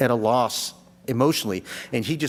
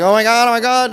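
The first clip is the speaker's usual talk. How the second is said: higher and louder, a burst of alarm; mock panic; 8 semitones higher, 7 dB louder, faster than usual. Seeing the cooler in the room, high mock panic. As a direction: gasp it out like you're startled.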